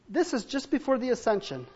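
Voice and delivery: normal talking voice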